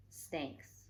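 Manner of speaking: hushed, spooky whisper; soft scary-story whisper. Acted. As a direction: breathe the word like a secret fear. Scary snakes build-up in story.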